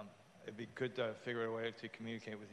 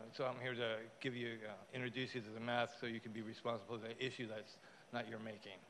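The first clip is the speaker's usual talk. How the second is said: ordinary talk, nothing marked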